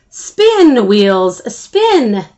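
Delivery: gleeful command; excited cheer. Acted. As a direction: cheer the wheels on. Timothy cheers the wheels.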